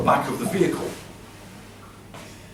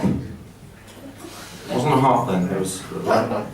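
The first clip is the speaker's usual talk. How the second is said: quiet aside; 6 semitones lower, 5 dB quieter, much slower than usual. Real, not acted. Muttered aside.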